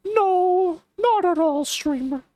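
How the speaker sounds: put-on high, nerdy teen voice reading cringe chat; Morty voice. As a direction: squeak it out like an awkward Morty. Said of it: "in Morty's voice".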